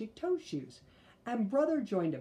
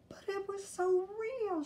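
put-on little girl voice. Sister still upset.

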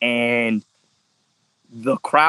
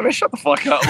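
acted exasperated retort; 8 semitones higher than usual. Retorting to his teasing coach.

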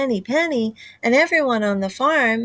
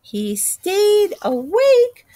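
put-on child voice; 7 semitones higher than usual. Chick tells her own story.

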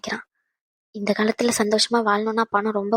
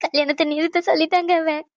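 wailing; 6 semitones higher than usual. Acted. Mother lamenting the cancelled wedding.

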